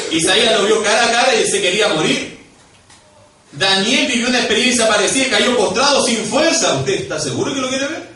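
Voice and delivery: high, loud preaching; fervent, confronting pulpit delivery; impassioned challenge. Real, not acted. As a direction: press the warning at full force. Preacher challenges congregation with examples.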